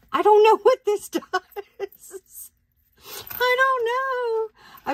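very high, drawn-out laughing squeal; real laughing bafflement, slow and high-pitched; helpless laughter. Real, not acted. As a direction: giggle through the words, utterly baffled.

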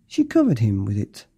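ordinary delivery

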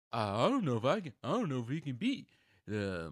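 quoting Giannis. Retold or imitated. Acting out Giannis's doubtful quote.

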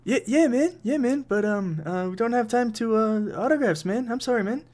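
put-on high, apologetic New Zealand accent. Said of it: "guy with a New Zealand accent".

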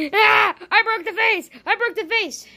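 shocked cry. Acted. Vase smashed, shocked.

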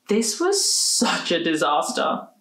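ordinary delivery, nothing marked

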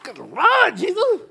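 high, loud cry of dismay; mock horror at the bill; shocked exclamation. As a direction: gasp it out as if stunned by the request. Shocked at paying for strangers.

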